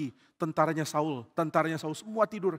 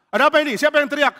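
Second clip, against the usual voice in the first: high, loud demanding questions; alarmed, raised-voice challenge; startled shout; 12 semitones higher, 10 dB louder, faster than usual. Acted. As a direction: jolt awake and demand answers. Abner terbangun oleh teriakan.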